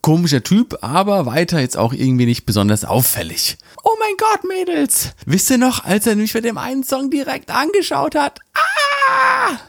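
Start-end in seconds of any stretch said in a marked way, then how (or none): 3.8-9.6 high squealing fangirl voice; giddy party girl. Acted. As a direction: squeal it to your girlfriends. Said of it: "squealing to the girls".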